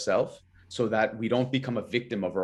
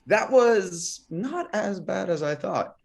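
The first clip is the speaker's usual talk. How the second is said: pleasant surprise; 8 semitones higher, much slower than usual. Retold or imitated. Surprised verdict after class.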